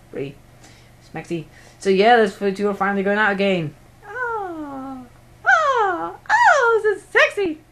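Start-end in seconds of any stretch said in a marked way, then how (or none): 4.1-7.6 very high, drawn-out falsetto oohs, climbing and getting louder; mock squeal. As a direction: squeal with hammed-up delight over a couple. Mock swooning at couple reunited.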